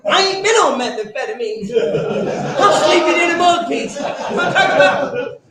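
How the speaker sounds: loud, high, half-laughing yell, slowed. Retold or imitated. Worker yelling back at the derrick hand, loud, high, half-laughing. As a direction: shout him down, laughing at the madness.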